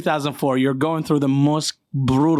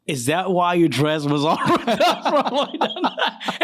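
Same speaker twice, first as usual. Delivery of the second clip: laughing; 3 semitones higher than usual. Real, not acted. Laughing while asking buddy.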